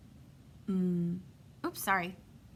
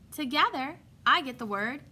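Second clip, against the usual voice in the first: ordinary talk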